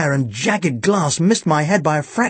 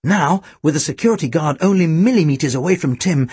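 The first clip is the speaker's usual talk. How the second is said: plain reading voice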